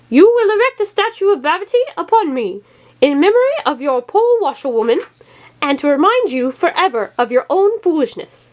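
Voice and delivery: high commanding witch voice; stump witch. A narrator's reading. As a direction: dictate terms to the king. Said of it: "said the stump".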